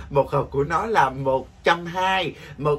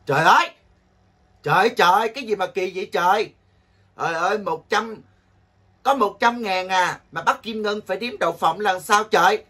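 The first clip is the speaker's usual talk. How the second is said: loud, higher mock outrage; mock exasperation; 4 semitones higher, 4 dB louder than usual. Host fake-fuming at a viewer's silly request, loud and higher. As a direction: blow up in playful indignation.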